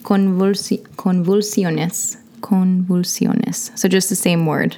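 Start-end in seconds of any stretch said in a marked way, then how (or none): 1.0-3.7 convulsiones slow, Spanish vowels, syllable by syllable; Spanish pronunciation. Shown as a demonstration. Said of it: "just like in a Spanish accent".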